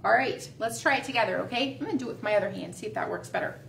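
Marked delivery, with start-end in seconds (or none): none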